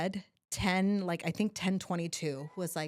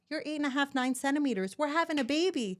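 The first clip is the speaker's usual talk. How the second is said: nurse announcing; 8 semitones higher than usual. Retold or imitated. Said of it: "They're like".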